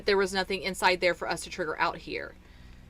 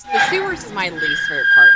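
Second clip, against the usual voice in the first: much louder complaint; 14 dB louder, faster than usual. Real, not acted. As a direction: blurt it out loudly. Sudden loud complaint.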